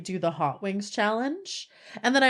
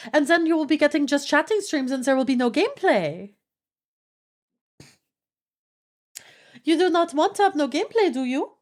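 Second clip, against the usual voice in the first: put-on higher-pitched, protesting French accent; mock French accent; 6 semitones higher, 3 dB louder than usual. Streamer scolding chat over yapping, high mock French accent. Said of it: "keep doing the French accent".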